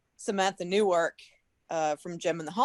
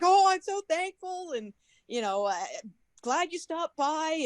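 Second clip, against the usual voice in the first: imitated tearful gratitude; 9 semitones higher than usual. Signer thanking a crying fan, mock-tearful and high. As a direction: imitate someone gushing through tears. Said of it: "and then she starts crying".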